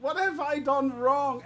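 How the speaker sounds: high, loud, drawn-out lament; hammed-up wailing plea; mock anguish. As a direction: cry out to the heavens in fake despair. Mock despair over a bad joke.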